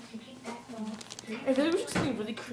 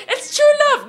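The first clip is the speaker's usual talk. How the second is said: meme quote; 11 dB louder, slower than usual. Retold or imitated.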